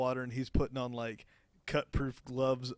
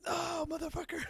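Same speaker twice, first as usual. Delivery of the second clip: shrieking way up high, startled; startled high cry. Real, not acted. Diver bitten underwater, yelping high in shock. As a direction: yelp it out high in sudden fright.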